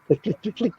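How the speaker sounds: clicking noises. Retold or imitated. Imitating licking sounds.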